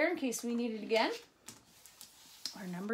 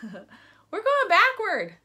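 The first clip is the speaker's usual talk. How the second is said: laughing; 8 semitones higher, 9 dB louder, much slower than usual. Real, not acted. Answers her own question.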